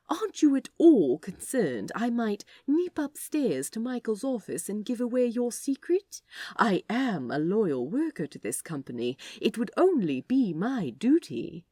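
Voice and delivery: hushed, hammed-up conspiratorial tease; theatrical mock threat. A narrator's reading. Aziraphale teasing Crowley in a theatrical whisper. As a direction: play the loyal worker with relish. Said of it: "more theatrically than the situation called for".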